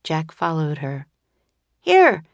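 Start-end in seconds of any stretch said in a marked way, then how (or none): 1.8-2.2 put-on young boy shouting high and loud; a boy yelling across the courtyard. A narrator's reading. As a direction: call out to catch the ball. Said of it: "shouted a young boy".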